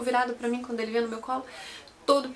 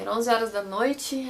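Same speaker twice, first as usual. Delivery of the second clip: ordinary talk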